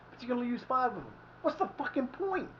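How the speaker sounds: exasperated. Real, not acted. Ranting about substitution rules.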